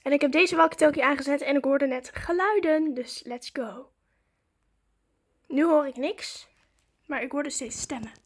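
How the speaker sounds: usual speaking voice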